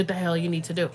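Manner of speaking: usual speaking voice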